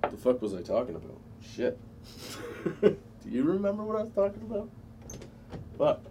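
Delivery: lost his train of thought; stoned voice; stoned and forgetful. Real, not acted. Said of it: "I'm really high right now".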